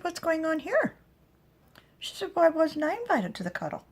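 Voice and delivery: high, loud mock outrage; high, loud mock-offended mother; mock-indignant mother. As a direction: play up the hurt at being left out. [name] voiced mock indignant.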